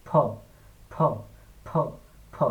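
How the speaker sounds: "ফ" as a popped p-h, burst of air; "ফ" with lips shut then puffed open, between প and ফ; aspirated P. Shown as a demonstration. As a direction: repeat "ফ" in separate puffs. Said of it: "ধুম করে একটা বাতাস বের হবে".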